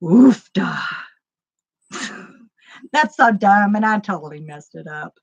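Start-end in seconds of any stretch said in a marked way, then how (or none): 0.0-0.8 a lightly acted "Oofta" of relief; relieved oofta. The rider after the camel stops, mild relieved exclamation. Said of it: "and he said".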